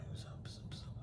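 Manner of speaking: whispered mumble imitating low-talkers; imitating dudes who speak low, all breath; mumbled low greeting. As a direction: barely voice the greeting. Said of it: "like, speak low".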